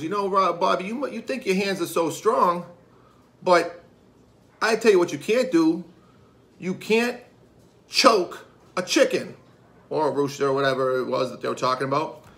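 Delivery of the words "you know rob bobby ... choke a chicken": higher-pitched, goading challenge; acted taunt. Friend goads father at the pub, taunting. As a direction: needle him, stretching out the final dare.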